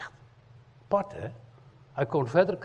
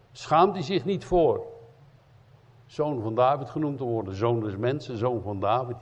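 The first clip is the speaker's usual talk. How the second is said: ordinary talk, nothing marked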